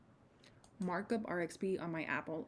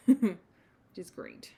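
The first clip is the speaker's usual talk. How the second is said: laughing; 10 dB louder than usual. Real, not acted. Laugh heard.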